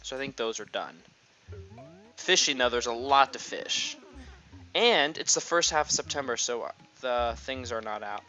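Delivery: ordinary talk, nothing marked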